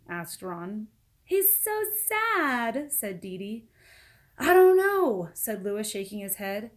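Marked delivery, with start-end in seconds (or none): 1.2-2.9 put-on sad girl. 4.4-5.3 put-on child voice. Sad child answers.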